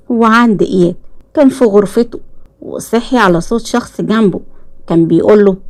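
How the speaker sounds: plain reading voice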